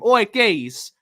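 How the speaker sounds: loud cheeky call, falling off on son; imitating Perch. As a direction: bark it like a mate on the phone. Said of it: "that's how Perchy talks off stream".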